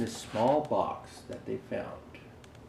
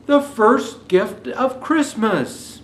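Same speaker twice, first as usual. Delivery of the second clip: Santa calling out, higher and slow; called out; 11 semitones higher, 9 dB louder, slower than usual. Acted. Santa calling to the cheering elves, slow proclamation. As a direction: proclaim it slowly to the crowd. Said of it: "called out".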